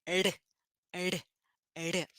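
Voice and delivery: brief separate R taps, softly; very quick tap. Shown as a demonstration. Teacher showing how fast the tongue hits, quick tap. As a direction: flick each R once on the palate. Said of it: "Bem rapidinho".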